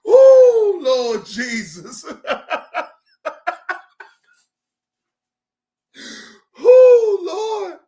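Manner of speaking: high squealing laugh, real; crying out between laughs; helpless laughter. Real, not acted. Howling with laughter on stream.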